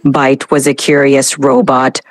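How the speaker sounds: low, even robot voice; robotic voice. Shown as a demonstration. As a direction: drone it like a dramatic robot. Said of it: "Initiating dramatic robotic voice".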